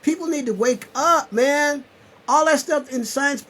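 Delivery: high and loud, still urging; fired-up preaching. Real, not acted. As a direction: keep shaking them awake. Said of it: "I'm talking like that because".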